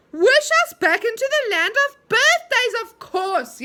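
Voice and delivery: high, loud excited shout; excited yell. A narrator's reading. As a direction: cry out all together, thrilled. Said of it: "yelled everyone in excitement".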